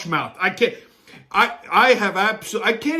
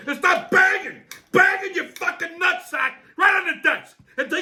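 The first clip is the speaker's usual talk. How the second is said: much higher, shouting abuse; furious rant; 7 semitones higher than usual. Real, not acted. Yelling at the TV host Chaz, furious. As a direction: blow up and yell him down.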